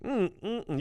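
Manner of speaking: ordinary talk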